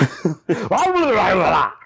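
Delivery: mock speaking in tongues. Co-host asks for tongues, player gives mock ecstatic babble. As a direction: burst of babbled nonsense syllables. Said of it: "Start speaking in tongues".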